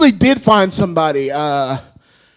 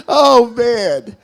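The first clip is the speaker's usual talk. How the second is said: ordinary talk, nothing marked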